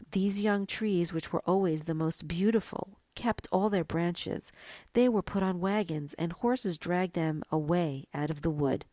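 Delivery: ordinary delivery, nothing marked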